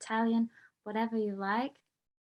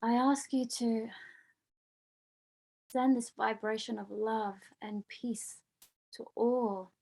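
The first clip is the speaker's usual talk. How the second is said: heartfelt prayer. Acted. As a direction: pray aloud for the whole group, unhurried. Leads group prayer for peace.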